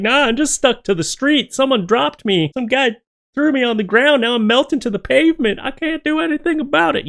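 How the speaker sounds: a little squeaky cartoon pizza complaining.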